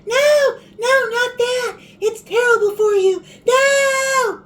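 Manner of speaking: high, loud mock outcry from viewers; mock viewer scream. Viewers screaming at the cook about the oil, high loud mock shout. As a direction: shriek it like a horrified viewer. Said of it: "some of you are gonna be screaming".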